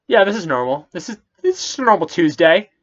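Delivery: mock nonchalant. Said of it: "and Balaam just goes".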